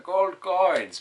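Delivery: hammed-up eager friend; imitating coin-hungry friend. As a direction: mimic him begging for coins. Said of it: "so he'd be like".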